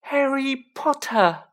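high, loud squeaky elf voice; high-pitched Dobby. A narrator's reading. Elf meeting his hero, high-pitched and loud. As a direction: pipe up in a high, carrying elf voice. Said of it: "in a high-pitched voice".

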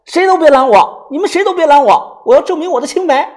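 high, loud proclamation; theatrical wronged outburst; melodramatic defiance. Acted. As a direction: shout it over your shoulder. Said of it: "边走边回头说".

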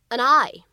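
and I blended into one sound; linked and I. Shown as a demonstration. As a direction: link "and I" smoothly. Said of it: "非常自然的连读".

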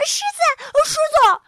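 very high, loud scream; shrieking alarm. Acted. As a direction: scream it in panic. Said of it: "他们尖叫着".

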